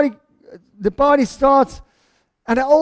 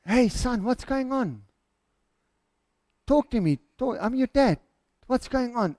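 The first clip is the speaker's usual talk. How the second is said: gentle fatherly coaxing; 7 dB quieter than usual. Acted. Dad going out to speak to his son, gently coaxing. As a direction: coax him to talk, quietly.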